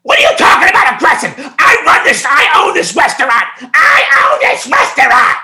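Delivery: very high, loud mock screaming; imitating yelling owner. Owner yelling at the delivery worker, shrieking mock outrage. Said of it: "She starts yelling at me".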